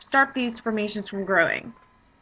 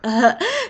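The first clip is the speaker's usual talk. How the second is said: embarrassed laugh; 4 semitones higher, 4 dB louder than usual. Real, not acted. Laughs at own stumble.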